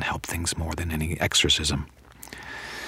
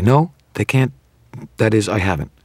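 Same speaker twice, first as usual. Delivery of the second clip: higher, hurried denial, then faltering; quick denial; 5 semitones higher than usual. A narrator's reading. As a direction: backtrack on a hasty answer. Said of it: "she said quickly".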